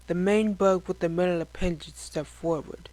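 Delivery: ordinary delivery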